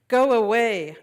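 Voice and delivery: put-on loud childish shout. Boy shouting at grandfather, loud and upset. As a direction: yell it at grandfather. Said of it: "An shouted".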